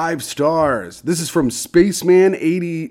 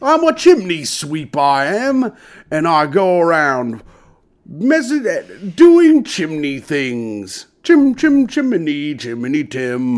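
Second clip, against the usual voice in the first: Cockney chimney sweep; slower than usual. Acted. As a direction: play up a cheery London sweep. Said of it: "I came in talking like this".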